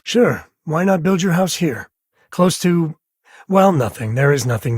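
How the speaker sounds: dry sarcasm rising in pitch; sarcastic. Acted. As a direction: poke fun at the choice. Sarcastic about remote house.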